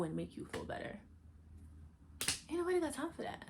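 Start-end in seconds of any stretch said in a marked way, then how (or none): 2.5-3.4 high sassy meme voice; meme quote. Retold or imitated. Dismissing consoling everyone with a high sassy meme line. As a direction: deliver it like the famous meme.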